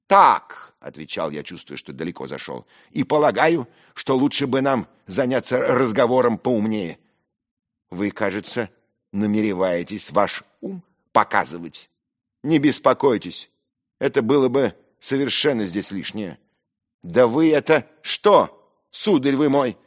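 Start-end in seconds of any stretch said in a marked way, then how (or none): 0.1-0.7 one loud, high, sharp word; forceful angry reply; defiant retort. Acted. Defiant answer in a quarrel. 2.9-16.4 loud, high, scornful argument; indignant lofty rebuke; haughty retort. Acted.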